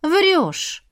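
high, swooping challenge; disbelieving. A narrator's reading. Sister doubting her brother has a girlfriend, disbelieving. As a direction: call the bluff in disbelief. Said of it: "не поверила я".

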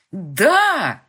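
put-on loud, eager kids' yes; eager shout. A narrator's reading. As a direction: shout it eagerly like excited boys. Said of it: "энергично закивали мальчишки".

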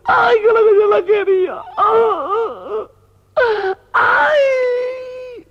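hammed-up wailing, extremely high; shrieking mock sobs and long squealed cries; crying. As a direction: wail slowly like a comic mourner. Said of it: "la mariquita llorando".

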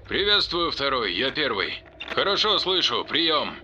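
high, thin voice over radio static; crackly military radio. Acted. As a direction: sound like a tinny radio voice. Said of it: "В эфире послышался треск".

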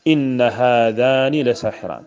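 slow recitation, إن with a held double n, "inna haadhaani"; other readers' recitation. Shown as a demonstration. Teacher demonstrating the remaining readers' version, slow with doubled noon. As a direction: recite slowly, doubling the n of إن before هذان. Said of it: "بقية القراء يقرؤون".

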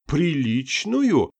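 outraged cry. A narrator's reading. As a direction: shout it in disbelief. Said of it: "вскричал Юдин". (возмущённо вскричал)

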